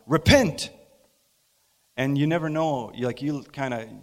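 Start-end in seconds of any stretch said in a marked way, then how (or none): 0.1-0.7 a sudden high, loud cry of repent; mock preacher yell; loud preaching call. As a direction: call out to the cattle from the corral fence. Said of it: "and I said to them".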